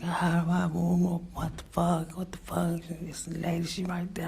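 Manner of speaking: high, held-down mock scream; retold screaming. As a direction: imitate her panicked screaming without full volume. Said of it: "she steady screaming".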